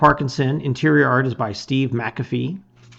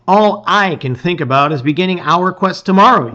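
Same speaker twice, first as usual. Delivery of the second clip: eager; 5 semitones higher, 7 dB louder than usual. Acted. Eager hero speaks of quest.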